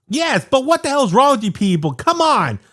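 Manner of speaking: high, exasperated protest; mock outrage. Real, not acted. Man scolding Halloween fans, mock outraged. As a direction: throw up your hands at them.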